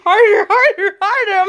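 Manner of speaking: high, half-laughing urging; mock urgent demand. Woman quoting her partner in bed, mock urgency. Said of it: "he's like".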